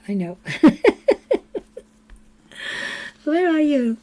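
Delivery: usual speaking voice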